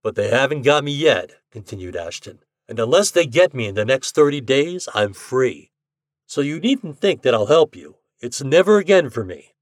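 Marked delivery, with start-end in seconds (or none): 0.0-1.4 a lightly voiced brash young gambler, louder; slightly louder, easy-going voice for Ashton; a hint of a cocky bettor. A narrator's reading. Ashton boasting. 2.7-9.5 a lightly voiced brash young gambler, louder; slightly louder, easy-going voice for Ashton; a hint of a cocky bettor. A narrator's reading. Ashton vowing.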